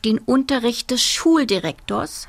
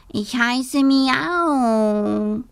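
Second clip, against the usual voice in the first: very slow, drawn-out higher cat voice; 4 semitones higher, much slower than usual. Acted. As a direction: purr the words out like a cat. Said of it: "sagte ein pechschwarzer Kater".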